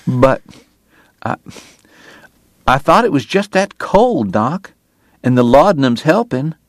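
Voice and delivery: a nervous younger man pleading; worried young man. A narrator's reading. Morgan's nervous protest.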